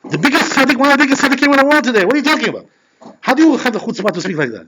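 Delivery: a retold furious rebuke; retold angry outburst. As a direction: defend the rabbi in mock outrage. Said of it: "starts to get angry at him".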